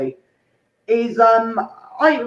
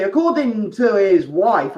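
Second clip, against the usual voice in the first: usual speaking voice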